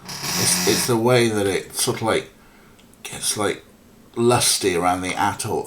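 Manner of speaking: normal talking voice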